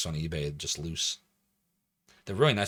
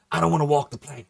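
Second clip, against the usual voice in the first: louder, higher mock pirate; piratey; 6 semitones higher, 6 dB louder than usual. Streamer in piratey hat bit, piratey. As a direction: play the pirate for the chat. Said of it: "be redeeming the piratey hat".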